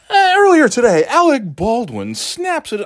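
mock news anchor. Comic mocking the talking head news voiceover, high and loud. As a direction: announce it like a breathless TV anchor.

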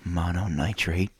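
put-on slow, lingering creepy old man. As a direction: drag each word out like a creepy old man. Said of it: "creepy old man voice".

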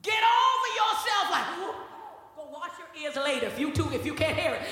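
loud rising sermon cadence; preaching at full force. Acted. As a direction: drive the point home to the congregation.

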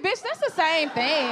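quick sassy put-down with a twist of voice; sassy retort. Retold or imitated. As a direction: snap it back at her. Retorting Jessica Lyft is same.

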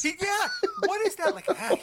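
high-pitched, incredulous question through laughter; cracking up, voice shooting up; laughing disbelief. Real, not acted. Podcaster baffled by an actor's voice, laughing high in disbelief.

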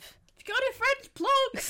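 high, loud call across the house; shouted across the house. Retold or imitated. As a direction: yell it to someone in another room. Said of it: "I just shouted it across the house".

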